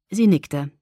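usual speaking voice

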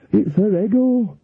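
high, slow feeble voice; Nanny Ogg weakly admits Granny's pride; weak old Nanny. Acted. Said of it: "said Nanny Ogg weakly".

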